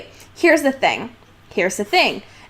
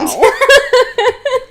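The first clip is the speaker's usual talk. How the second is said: a burst of high squealing laughter; 13 semitones higher, 4 dB louder than usual. Real, not acted. Cracking up at her co-host's awkward pause, high squealing laugh. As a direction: laugh up high and let it rise.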